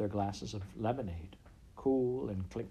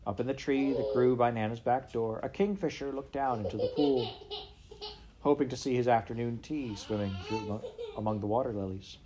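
ordinary delivery

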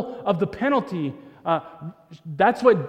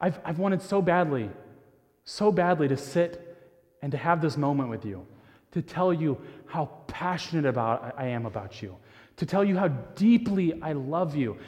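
soft, low, hushed words of love; tender fatherly confession; 5 semitones lower, 5 dB quieter than usual. Retold or imitated. Father telling his grown child how deeply he loves them, soft and hushed. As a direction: speak it softly, like a dad to his grown child.

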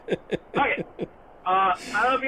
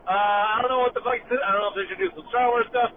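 usual speaking voice

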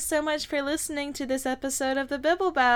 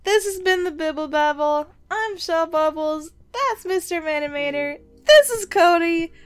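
raised, projected podcast outro; announcer sign-off; 4 semitones higher, 4 dB louder than usual. Acted. Host signing off the show, in an announcer voice. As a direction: build louder and higher through the names.

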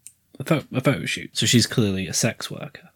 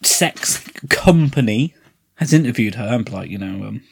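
ordinary talk, nothing marked

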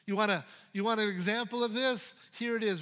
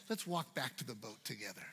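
tender Jesus; 10 semitones lower, 11 dB quieter than usual. Acted. Jesus speaks tenderly to Peter.

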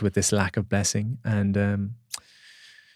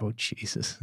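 much softer, deflated under the breath; stunned murmur; 11 dB quieter, slower than usual. Retold or imitated. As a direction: let it drop away as if caught out. Retold realisation after shaman's remark.

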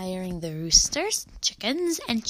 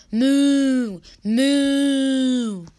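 long, loud, drawn-out moo; cow mooing; 4 semitones higher, 8 dB louder than usual. A narrator's reading. Cow answers the children, long loud moo.

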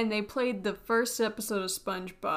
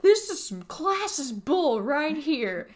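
angry customer; 4 semitones higher, 6 dB louder than usual. Retold or imitated. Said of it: "I just love her reactions".

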